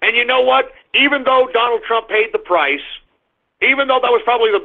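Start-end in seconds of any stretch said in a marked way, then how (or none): none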